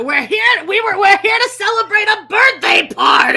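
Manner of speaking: high, loud hammed-up party announcement; mock reality TV shout. Quoting a Teen Mom scene to her co-host, hammed-up shout. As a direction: belt it like a reality star crashing the room.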